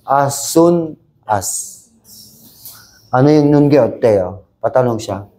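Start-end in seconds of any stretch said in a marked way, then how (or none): 0.1-1.8 slow model phrase. Shown as a demonstration. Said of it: "teacher models the phrase slowly".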